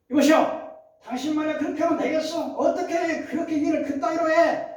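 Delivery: high, loud indignant scolding; angry scolding. Retold or imitated. Older employee scolding a young boss, indignant and loud. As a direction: snap at him like an offended elder employee.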